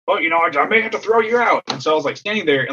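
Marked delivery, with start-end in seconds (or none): 0.1-1.6 high, worked-up mock warning; retold exasperation, pitched well up; exasperated umpire. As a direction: imitate a fed-up umpire laying down a warning. Umpire warns coach after storming up.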